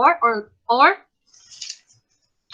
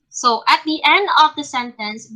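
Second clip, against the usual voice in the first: ordinary talk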